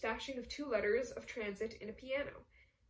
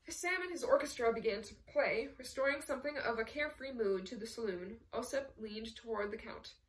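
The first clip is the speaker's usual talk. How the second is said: ordinary delivery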